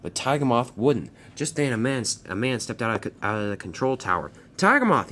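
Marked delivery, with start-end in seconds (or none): 4.6-5.1 high, loud yell of a name. Acted. Man from the control tower yells at Tiger Moth, loud. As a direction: call him out sharply from the tower. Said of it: "he yelled".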